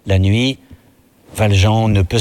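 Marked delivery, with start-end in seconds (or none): none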